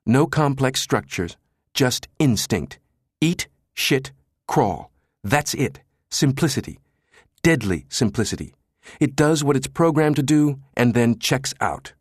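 lightly voiced intense tech expert; smoldering Jabba. A narrator's reading. Jabba spells out the worm's deadliness, intensely.

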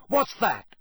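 high, challenging question; yelling back. A narrator's reading. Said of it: "Ron yelled back".

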